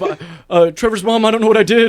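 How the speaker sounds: high, loud flustered pleading; mock panic. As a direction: call out for help in playful alarm. Acts out panicked appeal.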